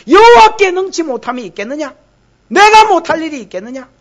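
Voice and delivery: loud, high, rising rhetorical demand; indignant challenge. Acted. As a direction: hurl the question as an offended God. Same verse as God's indignant challenge.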